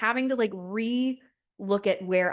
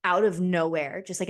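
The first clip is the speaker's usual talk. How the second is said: ordinary delivery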